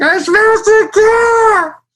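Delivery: loud, very high, drawn-out mock bawling; hammed-up crying shout; tearful wail. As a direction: howl the confession in fake tears. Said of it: "I was in tears".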